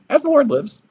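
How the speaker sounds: a mousy prophet squeaking his vow; squeaky high voice. Shown as a demonstration. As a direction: pipe it up high and timid. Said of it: "He could have had a voice like".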